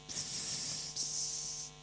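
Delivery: soft hissed psst; furtive psst. Retold or imitated. Timid believer to stranger at mall, furtive psst. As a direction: get someone's attention secretly.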